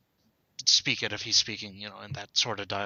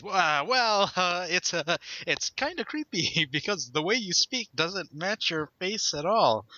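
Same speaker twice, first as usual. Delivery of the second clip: high, hesitant words broken by laughter; nervous laugh; 7 semitones higher than usual. Retold or imitated. Naruto nervously telling the old man he sounds creepy, laughing. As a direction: laugh nervously through the answer. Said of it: "he's nervously laughing here".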